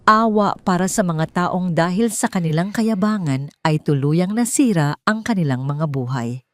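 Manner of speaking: ordinary delivery, nothing marked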